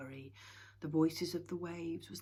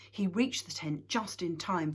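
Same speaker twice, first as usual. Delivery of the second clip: usual speaking voice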